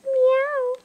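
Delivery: very high cat meow; kitty meow. Shown as a demonstration. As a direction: mew like a little kitten. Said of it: "What does a kitty cat say?".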